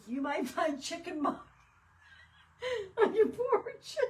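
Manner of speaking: punchline swallowed by laughing. Real, not acted. Quoting the chicken threat, laughing to tears. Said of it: "I'm about dying laughing".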